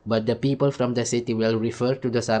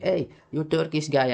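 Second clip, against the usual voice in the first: higher-pitched retold call; retold hail; 5 semitones higher than usual. Speaker to a guy he used to know, retold hail. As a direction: re-enact hailing an acquaintance. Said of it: "I would say to one guy".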